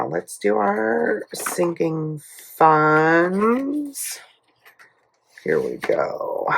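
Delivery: ordinary talk, nothing marked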